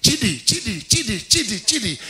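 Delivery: put-on high, excited kids' chant of a name; quick, high-pitched repeated calling; imitated children calling. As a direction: call out like children spotting a friend's car. Imitates children calling as car arrives.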